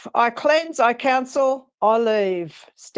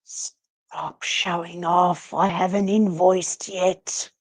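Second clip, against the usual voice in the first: whisper; 3 dB quieter than usual. Acted. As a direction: whisper it so only he hears. Medium hisses at showy ghost.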